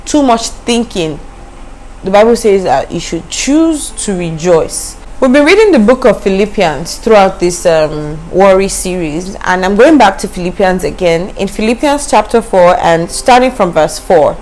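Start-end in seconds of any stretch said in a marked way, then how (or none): none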